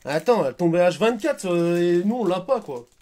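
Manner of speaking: high, loud thrilled talk; excited shout. Real, not acted. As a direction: shout it with joy. Both cheer at the rare card.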